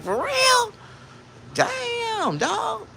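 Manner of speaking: very high falsetto faux concern; mock sympathy. Comic pretending to sympathise with his friend, high falsetto fake concern. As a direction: squeak it like a fake-sorry friend. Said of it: "I just be like".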